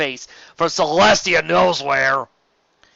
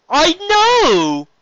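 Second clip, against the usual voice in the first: high, loud exasperated yell; much higher, louder retort; strained shout; 10 dB louder than usual. Acted. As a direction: shout back while straining. Steven strained reply.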